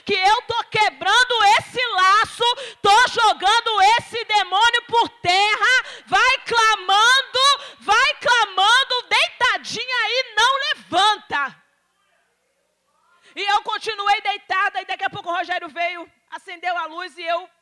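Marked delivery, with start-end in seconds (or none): 0.0-11.6 loud, slow, building commanding voice; voice of the Lord. Retold or imitated. God urging her to keep praying in bed, loud and slow, building. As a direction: proclaim it like God breaking chains. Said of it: "o Senhor falando ao meu ouvido".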